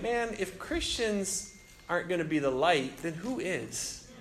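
soft, subdued retold thought; saddened. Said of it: "I was just saddened".

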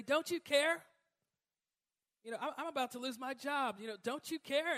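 high, loud pleading to God; half-laughing mock plea. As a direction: beg God with a touch of whining. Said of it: "look back at God and say".

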